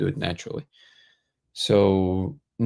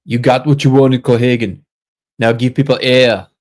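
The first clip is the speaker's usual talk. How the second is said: put-on loud, higher Austrian-accented movie hero; Arnold Schwarzenegger; 4 semitones higher, 9 dB louder than usual. Streamer quotes Total Recall, shouted Austrian-accented impression. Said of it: "That's my best Arnold impression".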